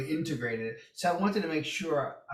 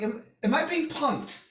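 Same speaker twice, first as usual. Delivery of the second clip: much higher, disbelieving am I being punked; incredulous; 13 semitones higher, 5 dB louder than usual. Retold or imitated. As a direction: ask in shocked disbelief, pitch climbing high. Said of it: "I'm like".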